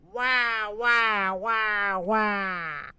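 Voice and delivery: put-on very high wailing baby; baby yelling. A narrator's reading. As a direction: wail like a terrified baby. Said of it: "it yelled as loudly as it could".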